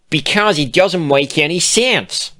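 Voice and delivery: loud, high exasperated outburst; exclaimed. A narrator's reading. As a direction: blurt it out in frustration. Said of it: "Bon Bon exclaimed".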